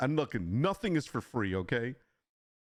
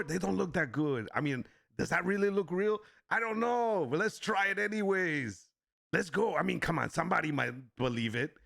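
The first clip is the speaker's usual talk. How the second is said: higher-pitched mock voice of scheming bros; hammed-up eager conspirator, pitched up; pretend scammer bros; 6 semitones higher than usual. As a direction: play goofy scammers egging each other on. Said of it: "I can't do a Nigerian accent, so I'm gonna pretend".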